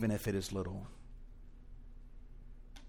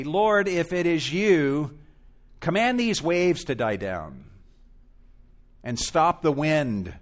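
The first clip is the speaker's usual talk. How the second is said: mock demanding plea; 4 semitones higher, 6 dB louder than usual. Peter pictured demanding Jesus calm the storm, higher and louder. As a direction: overplay the request Peter never made. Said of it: "Peter didn't say".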